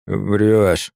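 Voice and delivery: put-on inarticulate drunken mumble; mumbled moo. A narrator's reading. Sleepless drunk elder unable to wipe his eyes, mooing his disbelief. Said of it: "Он только промычал".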